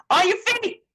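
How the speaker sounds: high, bright exclaim; triumphant reveal of the answer; answering her own quiz with flair; excited answer. Shown as a demonstration. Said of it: "answers own quiz question".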